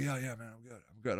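sleepy user mumbling he is fine; mumbled stoner reply. Retold or imitated. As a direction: play the nodding-off user slurring out an answer. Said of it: "He's like".

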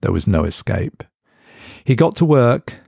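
ordinary delivery, nothing marked

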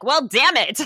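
loud mock-frustrated outburst; hammed-up annoyed parent; exasperated parent. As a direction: throw up your hands at the clever kids. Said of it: "then you're like".

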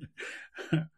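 soft breathy laugh through tears; a quiet, emotional little laugh; tearful laugh. Real, not acted. Moved by the download milestone, soft tearful laugh. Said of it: "I'm getting a little emotional".

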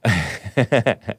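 short rapid chuckle; real chuckle. Real, not acted. Host laughing at what he just read, real chuckle. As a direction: chuckle to yourself.

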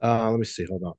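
muffled mic sound; muffled, like through a wall. Real, not acted. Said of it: "you sound very muffled".

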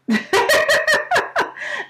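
real high laugh at own joke; amused burst of laughter. Real, not acted.

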